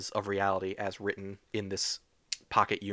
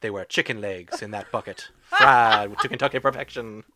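noir narration; 5 dB louder than usual. Pretend. Pulp noir narration parody.

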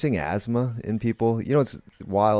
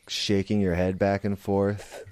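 usual speaking voice

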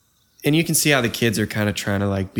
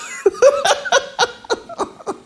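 breathless high laugh; airy laugh; 4 dB louder than usual. Real, not acted. Laughing at her own president joke, airy laugh. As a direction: let out an unvoiced laugh.

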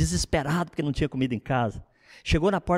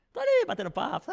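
ordinary delivery

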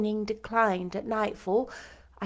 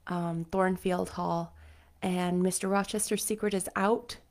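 ordinary delivery, nothing marked

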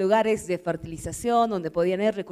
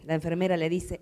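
normal talking voice

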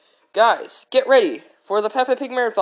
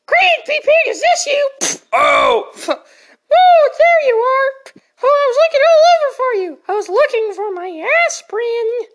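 very high, loud, fluttering granny; fussy old lady; 9 dB louder than usual. Acted. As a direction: fuss like a flustered grandma.

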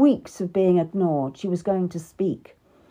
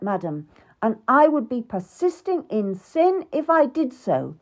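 louder, higher angry king; 5 semitones higher, 4 dB louder than usual. Acted. King Henry to the queen, angry and loud. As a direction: lash out at her complaints. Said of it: "He was really angry now".

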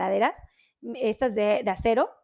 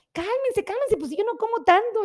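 high, playful pretend protest at the huge portions; lightly acted overwhelmed voice; mock exasperation; 9 semitones higher than usual. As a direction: tell the giant chicken to calm down, jokingly. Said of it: "Yo sí".

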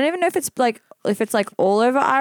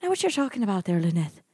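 soft, breathy put-on Irish; breathy Irish accent; 5 dB quieter than usual. Co-host feigning confusion, breathy Irish accent. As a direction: put on an airy Irish lilt, quietly. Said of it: "You're so breathy when you do an Irish accent".

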